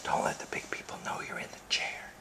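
whispering. Real, not acted. Parent whispering to toddler on the furniture, hushed whisper. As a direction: keep it secret, whisper so nobody hears. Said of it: "keep it quiet".

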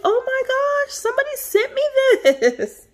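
high, loud squeal of delight; excited squeal. Retold or imitated. Retelling opening the mystery gift, high excited squeal. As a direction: squeal like she just opened the surprise. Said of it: "I was like".

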